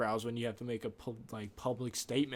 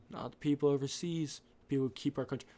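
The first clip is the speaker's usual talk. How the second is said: usual speaking voice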